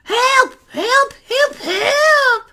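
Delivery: very high, shrieky cries without much volume; screaming lady. Acted. Lady begging passers-by for help, high mock screams. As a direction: cry out high in mock panic. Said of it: "lady who was yelling and screaming".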